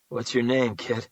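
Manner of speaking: ordinary delivery, nothing marked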